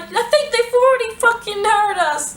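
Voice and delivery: high-pitched, re-enacted hysterical outburst; freaking out. Answering friend's shushing, high freaked-out squeal. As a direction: squeal back in exaggerated panic. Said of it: "seriously freaking the fuck out".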